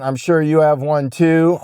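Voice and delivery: usual speaking voice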